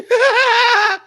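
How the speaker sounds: high shrieking laugh; loud burst of laughter. Real, not acted. Cohost laughing hard at a two-step jab, shrieking laugh. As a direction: crack up loudly. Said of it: "big laugh".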